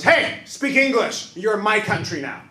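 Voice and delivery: loud, high, slow angry demand; rude stranger yelling. Retold or imitated. Lady in buffet scolds Mandarin-speaking parents, loud mock yell. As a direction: bark it like a hostile stranger in a buffet line. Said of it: "turns around and goes".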